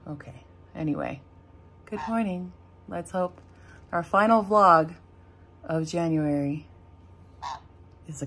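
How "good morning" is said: usual speaking voice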